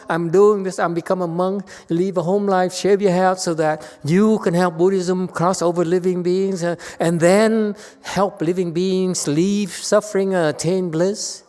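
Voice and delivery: mock the preachy monk's grand promises; mocking pious monk. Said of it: "Chinese monks and nuns talk like this".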